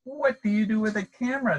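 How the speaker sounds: high, lightly voiced questioning penguins; curious penguins. A narrator's reading. Other penguins ask the little penguin, high curious. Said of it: "the other penguins ask".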